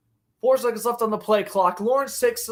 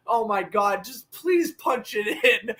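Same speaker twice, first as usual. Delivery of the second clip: ordinary talk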